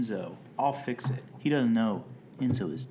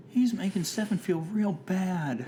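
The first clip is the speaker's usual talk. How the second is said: high, dreamy breathy-free lady voice, much higher; half-hearted impression, pitched up; Jennifer Coolidge impression; 8 semitones higher than usual.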